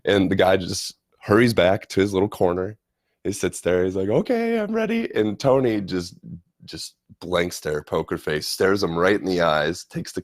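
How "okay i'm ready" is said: very high, eager imitated voice; eager German guy. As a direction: mimic him squeaking with readiness. Eager German guy awaiting the throw.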